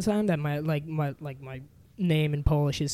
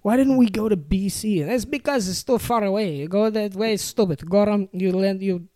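hint of a Polish grandpa insisting; Polish grandpa accent; 3 semitones higher than usual. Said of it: "his accent".

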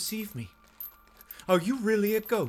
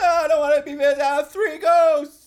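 high, loud, drawn-out yell of fright; panicked yell; 8 semitones higher, 9 dB louder than usual. Acted. Chris yells in panic about three ghosts. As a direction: wail it out in terror. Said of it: "a full hour of screaming".

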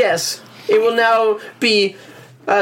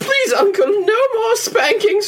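put-on high, crying child plea; 9 semitones higher than usual. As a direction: beg through tears, high. Boy begs ghost uncle.

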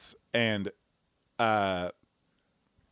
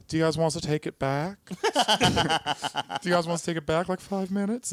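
higher, laughing mock timid offer; hesitant manager; 5 semitones higher than usual. Theater manager nervously offering to rewind, laughing. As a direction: ask meekly, stifling a laugh. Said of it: "the theater guy is like".

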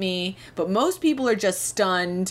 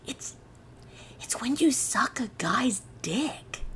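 hushed, secretive whisper; 3 semitones higher, 7 dB quieter than usual. Retold or imitated. Friend Kelly confiding over the phone, in a whisper.

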